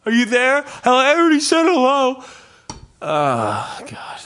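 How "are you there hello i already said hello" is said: high, whiny, exasperated; high whiny mock voice. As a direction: whine it like a flustered old man. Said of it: "And you're like".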